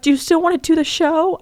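high, careful question; tentative text reading. Retold or imitated. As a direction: ask it in a meek high voice. Reads out her tentative text.

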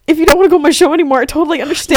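high, loud, rushed mimicry; mock-accommodating high gabble; mimicked anxious text.

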